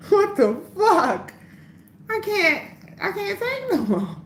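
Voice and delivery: high squealing overwhelmed cry; mock possessed outburst. Hammed-up possessed cry to her live viewers. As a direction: act overtaken, voice shooting up. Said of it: "I haven't gotten possessed in so long".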